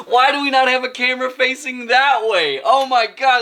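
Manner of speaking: high, incredulous delight; amused outcry, pitch way up; excited exclamation. Real, not acted. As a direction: exclaim it as if you missed a great shot. Excited after the perfect accidental shot.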